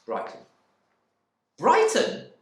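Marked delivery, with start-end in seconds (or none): 1.6-2.3 high, loud, outraged scream of the word. Acted. Wife screaming at her husband's answer, outraged. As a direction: shriek it back at him in disbelief. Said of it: "she screamed".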